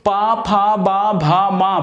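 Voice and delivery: पा फा बा भा मा made with the lips; pa-varga demo. Shown as a demonstration. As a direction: recite पा फा बा भा मा from the lips. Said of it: "practical करिए".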